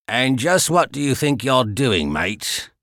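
a hint of a London dock guard's Cockney; thick Cockney accent. Guard challenging the intruder in thick Cockney. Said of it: "The guard spoke with a thick Cockney accent".

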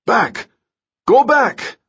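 loud, high alarmed shout. Acted. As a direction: yell the warning back. Guards shouting in the smoke.

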